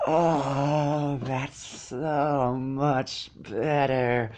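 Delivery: drawn-out moan of relief, very slow. Acted. Cold filly warming by the smoke, slow moan of relief. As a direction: sink into the warmth and let it out slowly. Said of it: "she moaned".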